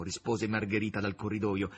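ordinary delivery

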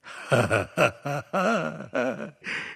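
noisy mocking cackle; a sardonic, staccato villain's laugh; loud sardonic laugh. A narrator's reading. Leclerc laughs as he sees his end coming, loud and sardonic. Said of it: "facendo una rumorosa risata sardonica".

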